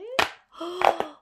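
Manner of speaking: sharp loud gasp of delight; big happy intake of breath. Real, not acted. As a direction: gasp at the surprise. Snails found out of shell.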